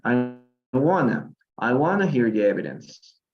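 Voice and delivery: "wanna" run together with no T; connected-speech "wanna"; popular contraction. Shown as a demonstration. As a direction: say "want to" as "wanna". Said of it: "esto se ha vuelto más popular".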